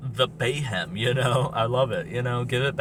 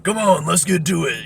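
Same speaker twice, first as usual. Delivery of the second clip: loud, higher-pitched rallying call; brawny tough guy; 6 semitones higher, 6 dB louder than usual. Acted. Fan voicing the brawn of the group, loud brawny rallying cry. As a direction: play the group's muscle, raring to go. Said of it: "So you think he's the, uh, you know, the brawn".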